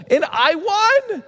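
high, drawn-out boast; slow, high-pitched exultant claim; acted triumph. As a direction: stretch it out like a delighted winner. Acting winner boasting of the win.

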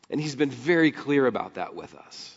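usual speaking voice

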